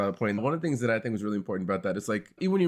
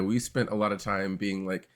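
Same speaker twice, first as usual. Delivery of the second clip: ordinary delivery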